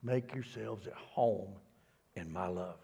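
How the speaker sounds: slow, soft reading of the verse; plain reading of the verse; slow scripture reading. Shown as a demonstration. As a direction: read it out slowly and gently. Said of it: "this is the Message translation".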